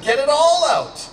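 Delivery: usual speaking voice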